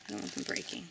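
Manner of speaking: breathy low whisper to herself; whispered aside. Real, not acted. Mother whispers to herself while changing the baby's bandages.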